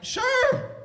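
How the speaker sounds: a high, laughing "Sure"; squeaky agreement. Retold or imitated. Grandson agreeing to roll the joint, squeaky. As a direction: voice cracking into a falsetto yes.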